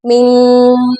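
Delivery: "मिन" with a sustained nasal n; ghunna. Shown as a demonstration. Teacher modelling ghunna, "मिन" held through the nose. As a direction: hum through the end of "मिन". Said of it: "यहाँ पर हमने गुना करना है".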